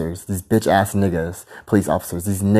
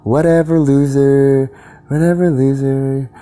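sneering whatever loser, pitched up; dismissive taunt; 5 semitones higher than usual. Retold or imitated. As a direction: toss it off like a bored teenager. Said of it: "And I say like this".

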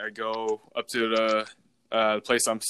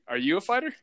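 questioning; 8 semitones higher, faster than usual. Retold or imitated. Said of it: "I was like".

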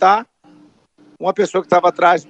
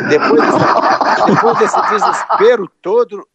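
laughing disbelief; 3 semitones higher, 4 dB louder, slower than usual. Real, not acted. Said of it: "laughing in disbelief".

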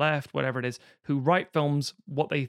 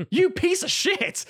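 much higher, slow, mock-indignant; mock outrage; 4 dB louder, slower than usual. Podcaster playing Ricky Bobby outraged at his father. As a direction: spit it at him in hammed-up disbelief.

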